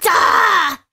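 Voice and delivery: loud frustrated shout; frustrated yell. Real, not acted. Streamer yelling in frustration at the rebrand news. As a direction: let out an angry yell. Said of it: "I'm pissed".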